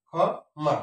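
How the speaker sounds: খ, pause, then মা; syllable by syllable. Shown as a demonstration. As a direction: split it 'khɔ... ma'. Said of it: "সঠিক উচ্চারণ". (খ... মা, থেমে থেমে)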